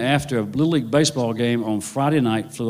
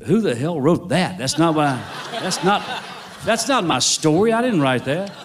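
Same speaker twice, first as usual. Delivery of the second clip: higher-pitched, mock-outraged protest; indignant disbelief; 4 semitones higher than usual. Author watching the film of his own book, indignant disbelief. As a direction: protest in comic bewilderment. Retelling his dismay at the film's ending.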